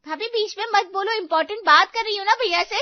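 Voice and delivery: high, loud, quick rebuke; irritated scolding. Acted. Irritated sister cuts off interrupting sister-in-law. (चिढ़कर ऊँची आवाज़ में डाँटना)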